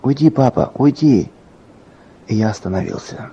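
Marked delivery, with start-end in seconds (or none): 0.0-1.3 put-on higher, mild cry of a little girl; agitated child's cry. A narrator's reading. Sick daughter when father steps closer, understated agitated cry. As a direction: cry it lightly, like an upset child. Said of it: "девочка начинала волноваться и кричать".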